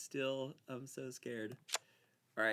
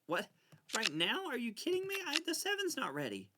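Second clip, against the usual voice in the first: much higher, alarmed "Are you kidding me?"; surprised protest; 11 semitones higher, 4 dB louder than usual. Real, not acted. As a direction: protest in shock. Startled protest.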